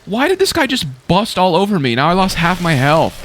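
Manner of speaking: high, indignant outburst; startled protest. Real, not acted. Player loses half his health to a blast, startled protest. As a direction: complain in high-pitched disbelief. Hit by exploding enemy.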